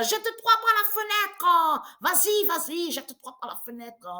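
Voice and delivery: replays the taunt high and giggly; laughing mock taunt. Retelling her taunt to the neighbour, laughing and high. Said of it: "elle a ri quand je dis ça".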